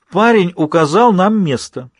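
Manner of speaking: excited. Acted. Doctor excited by discovery.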